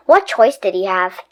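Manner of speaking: ordinary delivery, nothing marked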